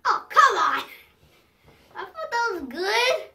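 loud, high indignant protest; playful protest. Real, not acted. Protesting the joke falls flat.

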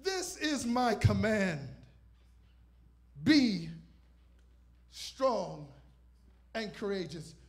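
emphatic sermon proclamation, loud then slowing; preaching. Acted. Preacher proclaiming scripture to the congregation, emphatic. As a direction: preach the verse with weight.

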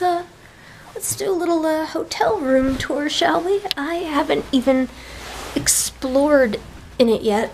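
ordinary talk

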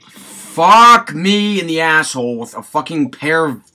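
raging gamer. Real, not acted.

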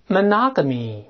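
"monogamy" with the second syllable stressed, then falling. Shown as a demonstration. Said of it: "重音在第二个音节". (“monogamy”重音落在第二个音节)

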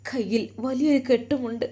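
usual speaking voice

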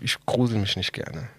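ordinary delivery

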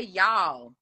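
"Y'all" as one drawn syllable; own Y'all pronunciation. Shown as a demonstration. Demonstrating how they say it, one-syllable "Y'all". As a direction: say "Y'all" as a single word. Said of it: "I say".

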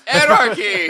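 high, loud laughing cry of anarchy; mock rallying cry. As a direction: yell it like a mock rally chant. Chanting a political slogan.

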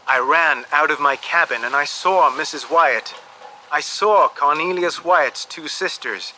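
ordinary delivery

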